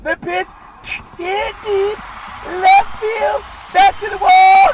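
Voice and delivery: very high falsetto call, building to a shout at the wall. Pretend. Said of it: "[name] doing play-by-play?".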